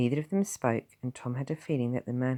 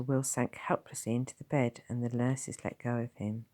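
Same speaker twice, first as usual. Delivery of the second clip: plain reading voice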